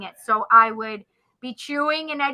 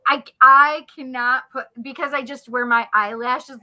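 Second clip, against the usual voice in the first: usual speaking voice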